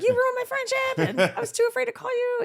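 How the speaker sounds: very high, loud mock wail; retold upset outburst. Retold tearful phone quarrel.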